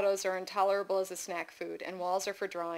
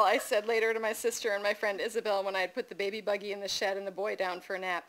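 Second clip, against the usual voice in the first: usual speaking voice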